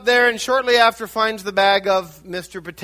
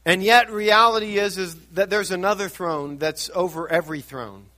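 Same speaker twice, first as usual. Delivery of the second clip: ordinary delivery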